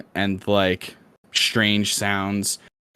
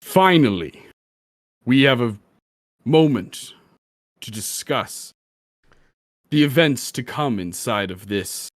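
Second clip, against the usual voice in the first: a lightly voiced grand stone statue. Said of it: "as the statue says".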